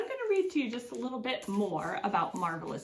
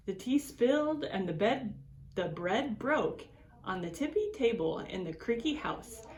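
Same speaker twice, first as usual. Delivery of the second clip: put-on reading to children. A narrator's reading. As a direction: read it like story time for little ones. Reads picture book to children.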